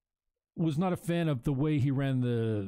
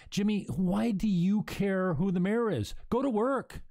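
higher-pitched nagging mother; mom voice; 5 semitones higher than usual. Acted. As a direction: scold like his mom, pitch climbing at the end. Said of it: "gonna put my mom voice on".

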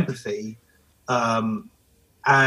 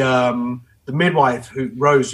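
ordinary talk

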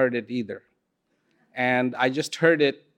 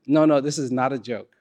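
ordinary delivery

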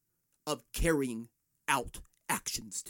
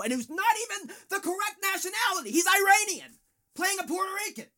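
outraged high-pitched rant; 5 dB louder, faster than usual. Real, not acted. Ranting to listeners about a miscast actor, pitched high in outrage. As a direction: voice shoots way up in disbelief.

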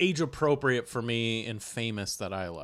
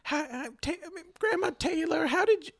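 high, small grandchild voice; lightly voiced grandkid asking grandma; 14 semitones higher, 4 dB quieter than usual. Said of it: "her grandkids to be like".

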